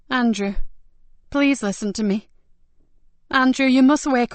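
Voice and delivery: rising to a loud plea on must wake up; urgent calling out. Acted. Trying to wake dying man.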